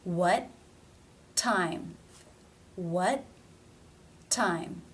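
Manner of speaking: "What" ending in a stop, a pause, then "time" with a true T; stop T, real T. Shown as a demonstration. Teacher drilling a sentence word by word, stopped "What", crisp "time". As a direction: say "What" and "time" apart, first T stopped, second released. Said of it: "it must be a stop".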